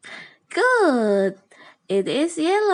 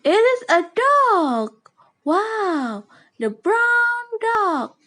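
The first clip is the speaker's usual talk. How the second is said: put-on high, bright voice for little kids; reading to a toddler; 9 semitones higher than usual. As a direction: announce it like a picture book reveal. Reading to small children.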